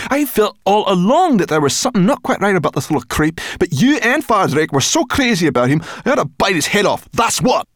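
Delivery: high, loud, fast raging threat; furious. Acted. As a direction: spit out the threat in rage.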